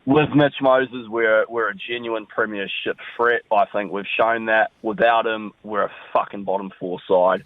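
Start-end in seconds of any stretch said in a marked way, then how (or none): none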